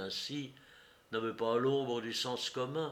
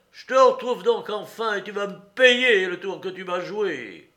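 loud, raised, vengeful shouting; furious threat; 8 semitones higher, 12 dB louder than usual. A narrator's reading. Courrapier has found his betrayer, furious. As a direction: shout it, carried away by rage. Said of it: "emporté par la colère".

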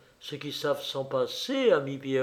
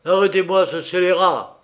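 loud, higher cry of command; shouted order; 4 semitones higher, 8 dB louder than usual. A narrator's reading. Prince ordering his guards onto Pierrot, shouted order. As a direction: yell for the guards to seize him. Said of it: "cria Horribilis".

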